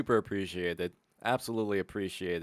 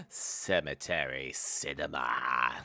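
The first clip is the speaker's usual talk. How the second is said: deep announcer voice; 9 semitones lower, 3 dB quieter, slower than usual. Acted. Announcing the show title.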